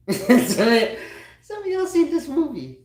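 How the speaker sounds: high, loud laughing through the words. Real, not acted. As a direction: crack up while asking. Laughing while telling.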